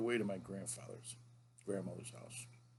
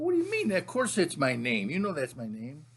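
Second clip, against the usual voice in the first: mock indignant protest; 3 semitones higher, 6 dB louder than usual. Grandson insists to grandpa on his name, mock indignant protest.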